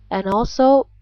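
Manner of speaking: ordinary talk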